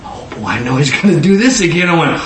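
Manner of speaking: normal talking voice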